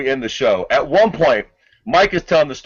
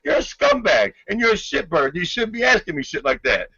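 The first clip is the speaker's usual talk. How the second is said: high-pitched imitated tirade of insults; a hammed-up furious Tyson; mock Tyson rant; 6 semitones higher than usual. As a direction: fire off the insults in a raised mock voice. Tyson retold cursing at reporter.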